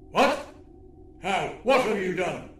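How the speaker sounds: high, startled alien demand; alarmed alien villain. A narrator's reading. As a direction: demand answers in sudden alarm. Alien villain alarmed by Lucy's news.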